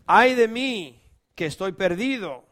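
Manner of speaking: sharp loud cry of despair; a loud lament; anguished cry. A narrator's reading. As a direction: cry out, undone. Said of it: "Entonces grité".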